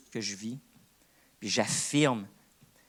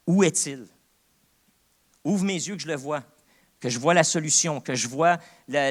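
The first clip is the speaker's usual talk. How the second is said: fervent prayer; 4 semitones higher, 7 dB louder than usual. Retold or imitated. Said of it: "je dis".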